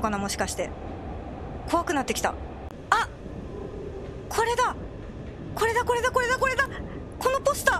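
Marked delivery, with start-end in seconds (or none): 2.9-7.8 high eager exclaiming; excited discovery. Real, not acted. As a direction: call it out, thrilled to find it. Spotting the anomaly. (発見して興奮)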